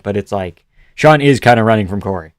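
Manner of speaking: ordinary talk, nothing marked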